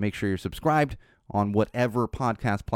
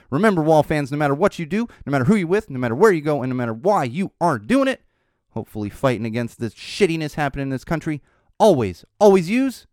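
high, loud, rallying announcer delivery; podcast sign-off; 6 semitones higher, 6 dB louder than usual. Acted. Host rallying listeners as he signs off, announcer style. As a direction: build a punchy catchphrase, lifting each phrase. Retakes signature sign-off after slip.

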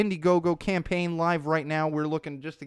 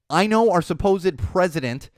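usual speaking voice

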